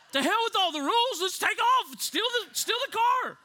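high, hollered mock abandon; reckless teenager. Fifteen-year-old left behind after rapture, reckless holler. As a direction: throw all caution away.

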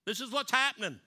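high, loud rebuke from above; booming voice of God. Retold or imitated. The heavenly voice scolding Peter, loud rebuke. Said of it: "And the voice said".

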